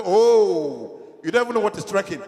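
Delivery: loud, exasperated father; loud disbelief at a child who never walked home; loud exclamation. Retold or imitated. Father scolding his daughter with a loud exclamation.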